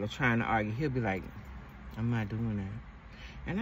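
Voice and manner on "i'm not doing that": very slow, soft, lower flat refusal; calm refusal. Retold or imitated. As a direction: calmly refuse to take the bait, drawn out. Boyfriend refusing to argue.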